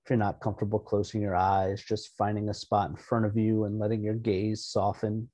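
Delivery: soft-spoken relaxation voice; guided meditation. Real, not acted. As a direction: settle listeners gently, leaving room to breathe. Leads an opening meditation.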